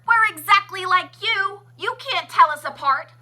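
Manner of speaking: loud, high taunting yell; gloating crowd yell. A narrator's reading. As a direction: shout it at the snooty ones. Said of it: "they yelled at the ones".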